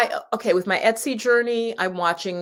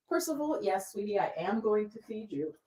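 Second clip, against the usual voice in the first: tender pet talk; 9 dB quieter than usual. Real, not acted. Talking to her pet.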